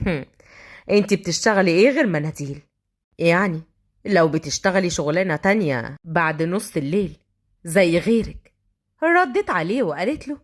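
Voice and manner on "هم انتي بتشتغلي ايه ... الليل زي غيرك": low, insinuating tough-guy voice; mocking thug. Thug sizing up tissue seller, low mocking voice. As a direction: drop the pitch and needle her. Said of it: "وضحك وقال".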